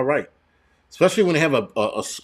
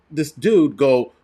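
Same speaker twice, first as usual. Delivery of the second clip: ordinary delivery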